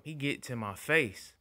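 usual speaking voice

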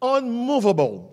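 unmovable said slowly in English, anmuvbɔl; preacher giving the English unmovable. Shown as a demonstration. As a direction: set unmovable apart, slow and careful. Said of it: "Mon anglais".

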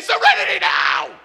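full-force comic shout; hammed-up explosion of fury; enraged scream. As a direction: bellow it as if totally losing it. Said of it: "I choke him and I say".

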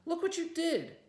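high, loud furious shriek; furious shrieking girl. A narrator's reading. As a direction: shriek it at her in fury. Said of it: "she shrieked".

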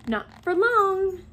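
high, drawn-out smug singsong; satisfied. A narrator's reading. Hermione on the nearly ready potion, satisfied. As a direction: savour it slowly, pleased with the plan. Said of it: "said Hermione in a satisfied tone".

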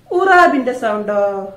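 ordinary delivery

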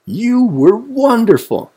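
very high, loud gushing praise; admiring cry. A narrator's reading. Friends praise Wombat, crying out in admiration. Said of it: "cried Weasel and Woodchuck".

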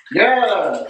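ordinary talk, nothing marked

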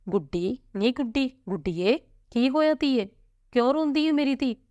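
put-on high, coaxing questions to a crying child; concerned mother. A narrator's reading. Mother asks her sobbing daughter what happened, concerned and coaxing. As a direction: narrate a worried mother, pitch lifting as she asks.

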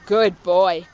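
deep, low praise for the dog. Real, not acted.

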